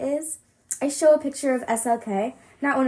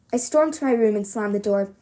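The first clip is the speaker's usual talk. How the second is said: plain reading voice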